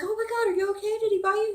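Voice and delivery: alarmed mom. Retold or imitated. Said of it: "And I'm like".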